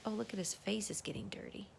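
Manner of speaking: fast whisper; quick whispered aside. Real, not acted. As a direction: rush it out in a whisper.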